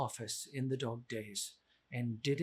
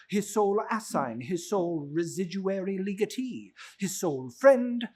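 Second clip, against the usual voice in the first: each sole rising, louder toward friend; building emphasis; 3 semitones higher, 5 dB louder than usual. A narrator's reading. Narrator piling up Scrooge's titles, building louder. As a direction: climb the list to a peak on friend.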